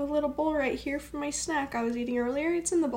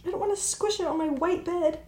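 freaking out; 6 semitones higher than usual. Real, not acted. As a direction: squeal and laugh, spider on your clothes. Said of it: "I would freak out if there was a spider on me".

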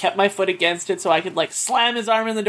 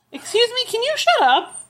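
annoyed snap. Real, not acted. Scolding the dog mid-talk.

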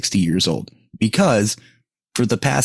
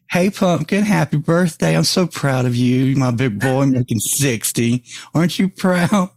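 put-on higher, sing-song baby talk to a grown man; mock doting voice; 4 semitones higher than usual. Prank caller teasing a friend on his birthday, mock doting. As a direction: coo at him like a proud mother. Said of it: "I said".

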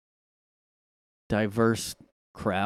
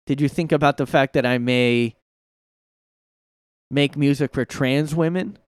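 mock [name] quote; 3 semitones higher, 5 dB louder than usual. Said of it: "she claps back at".

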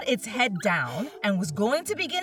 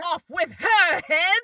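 shouting; 8 semitones higher, 4 dB louder than usual. A narrator's reading. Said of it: "went stomping about and shouting".

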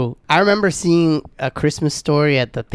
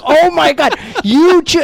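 much higher, louder mock astonishment; Oli; 14 semitones higher, 7 dB louder, slower than usual. Passenger catching the driver's sudden calm, loudly astonished. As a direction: exclaim it as an amazed accusation. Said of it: "And Oli's like".

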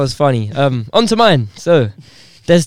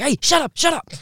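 higher-pitched mock telling-off; imitated angry neighbour; 7 semitones higher than usual.

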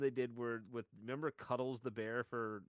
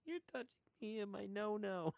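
voicing the bear; 10 semitones higher than usual. Telling a friend about the parody ad, squeaky bear voice. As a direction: whimper it like a traumatized stuffed bear.

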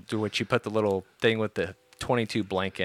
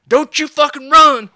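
high, very loud shouted order; panicked yell; 12 dB louder than usual. Retold or imitated. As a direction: yell it while dodging falling rocks. Panicked yell while dodging rocks.

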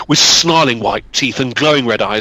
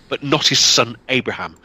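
usual speaking voice

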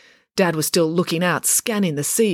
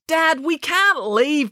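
high, drawn-out objection; protesting; 11 semitones higher, much slower than usual. A narrator's reading. April resists being marched out by Dad, protesting. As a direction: dig your heels in, stretching the words. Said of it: "protested April".

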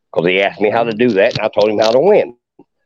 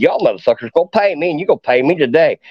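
high, heated retold yelling at the crew; angry demand; 7 semitones higher than usual. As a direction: demand payment, worked up and pitched high. Angry demand in parking lot.

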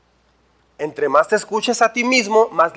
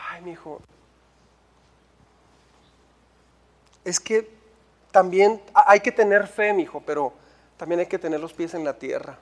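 hushed sigh, then low, halting reluctance; patronizing discouragement; 3 semitones lower, 6 dB quieter than usual. Acted. Saul discourages young David from fighting, soft and condescending. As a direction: let him down softly, as if humouring a child.

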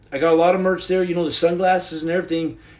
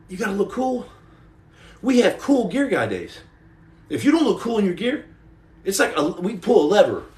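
ordinary delivery

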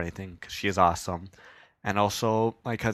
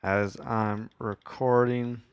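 slow, halting read-aloud; much slower than usual. Real, not acted. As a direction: read it out word by word, slowly. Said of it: "I'm reading so slow there".

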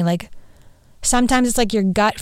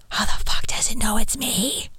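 a hushed, unvoiced aside; whisper; 3 dB quieter than usual. Real, not acted. Telling viewers about the face scanner, whispered. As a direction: whisper it like it's too creepy to say aloud.

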